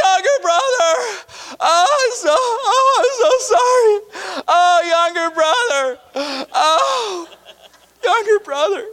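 high, crying and drawn out; tearful reunion. Acted. Elder brother crying to his younger brother, high and tearful. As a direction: sob your apology to your brother.

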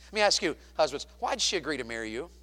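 ordinary talk, nothing marked

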